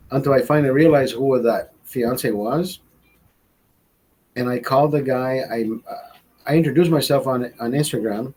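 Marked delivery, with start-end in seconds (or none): none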